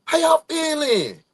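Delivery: high, loud, drawn-out morning call; high-energy greeting. Real, not acted. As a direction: holler good morning to rouse everyone. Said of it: "hear the energy in my voice".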